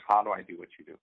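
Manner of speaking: normal talking voice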